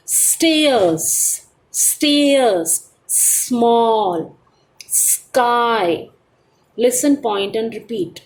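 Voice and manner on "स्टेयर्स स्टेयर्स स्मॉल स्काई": teacher models each word for the class to copy; clear model pronunciation. Shown as a demonstration. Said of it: "अब हमें कैसे इनको pronounce करना है?". (स्टेयर्स, स्मॉल, स्काई को साफ़ और धीरे बोलकर दिखाना)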